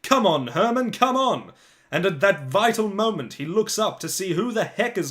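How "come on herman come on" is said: loud, high sideline shout from the dad; excited yell. Acted. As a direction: yell it across the pitch like an overexcited dad. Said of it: "he hears someone yell".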